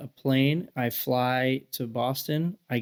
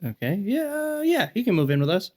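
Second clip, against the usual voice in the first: imitating mom, high pitched; 8 semitones higher than usual. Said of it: "She was like".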